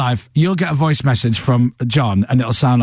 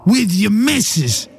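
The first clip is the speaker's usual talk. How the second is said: John Bishop stage voice; 8 semitones higher, slower than usual. Retold or imitated. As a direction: belt it out slowly like a Scouse comic on stage. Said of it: "if you've ever seen John Bishop on stage".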